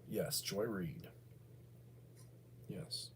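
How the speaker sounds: conspiratorial whisper naming her; mock secret whisper. Letting the audience in on a name, hushed whisper.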